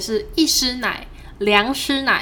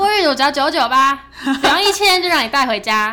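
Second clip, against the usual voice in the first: loud, laughing sales pitch; infomercial; 4 semitones higher, 6 dB louder than usual. Retold or imitated. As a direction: hawk it like a TV shopping host. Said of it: "对，搞直销的".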